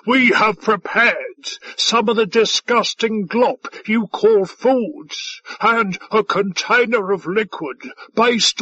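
high, boisterous boasting; slow, swaggering character voice; shouted. A narrator's reading. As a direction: play the overbearing, hearty alien host. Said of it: "he shouted".

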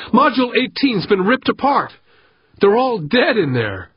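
high-pitched panicked report; frantic babbling. A narrator's reading. Life support worker bursts in with news, frantic babbling. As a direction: blurt the disaster out, pitched high. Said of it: "babbling so loud and fast".

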